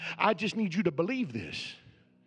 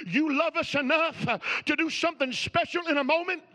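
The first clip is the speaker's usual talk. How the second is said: raised, louder preaching voice; 7 semitones higher, 5 dB louder than usual. Acted. Preacher telling the church about the wedding, in a raised preaching voice. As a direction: preach it out to the congregation.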